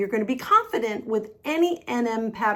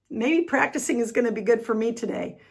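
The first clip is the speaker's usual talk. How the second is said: ordinary talk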